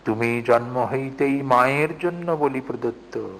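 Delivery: measured, grave reading of scripture-like prose; soft, solemn declamation; solemn recitation. Acted. Reciting Vivekananda's text aloud.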